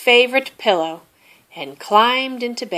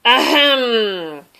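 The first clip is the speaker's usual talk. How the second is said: reminding throat clear; 4 semitones higher, 4 dB louder than usual. A narrator's reading. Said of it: "cleared his throat in a reminding".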